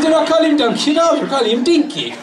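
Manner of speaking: high, laughing, partly shouted; laughing explanation. Retold or imitated. Retelling the punchline to the crowd, high and laughing. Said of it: "He says".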